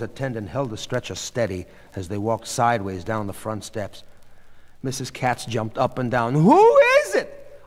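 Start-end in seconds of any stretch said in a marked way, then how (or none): 6.3-7.6 very high, drawn-out loud demand; agitated shout. A narrator's reading. Mrs. Katz jumping up and down, agitated shout. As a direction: cry out the question at full pitch.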